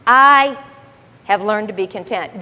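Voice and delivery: the word "I" punched hard and loud, then the rest drops; "I" loud and proud, "have learned to be content" falls back; strong emphasis on I. Shown as a demonstration. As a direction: hit "I" like a hammer, the rest settles. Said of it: "There's, there's strong emphasis".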